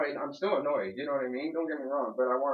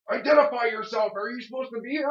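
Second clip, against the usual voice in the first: loud, higher barked order; mock guard demand; 8 semitones higher, 7 dB louder than usual. Tenant imitating the security guard demanding ID, loud mock order. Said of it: "And he's like".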